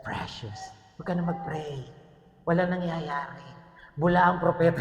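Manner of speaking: lightly voiced Gollum-like tempter; the enemy mimicking Lord of the Rings creature; Precious voice. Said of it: "Parang Precious lang yun, sa Lord of the Rings".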